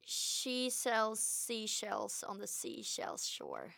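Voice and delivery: drawn-out tongue twister, half speed; slow recital. Shown as a demonstration. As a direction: say the tongue twister slowly and carefully. Tongue twister given to copy.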